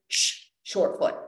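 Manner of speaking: slow hissed shh then short foot; hissed cue. Shown as a demonstration. Coach demos landing, hissed exhale cue. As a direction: hiss then cue slowly. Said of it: "I'll start by kind of doing this".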